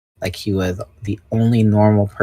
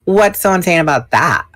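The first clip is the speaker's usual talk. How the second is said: snappy, higher and louder retort; sharp; 9 semitones higher, 8 dB louder than usual. A narrator's reading. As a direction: bite back at the doubter. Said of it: "Rachel asked sharply".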